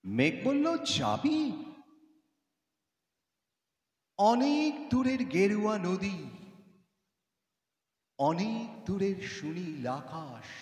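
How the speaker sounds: measured, expressive Bengali recitation with long pauses; poem recitation. Acted. Cloud coaxes a girl to come along, dramatic poetry recitation. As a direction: recite it as the cloud's lilting, rising invitation.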